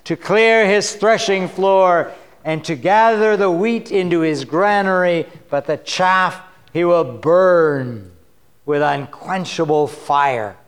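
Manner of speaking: solemn booming read of the Messiah's coming; prophetic declaration. Acted. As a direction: proclaim judgment, slowing at the end. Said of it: "John declares".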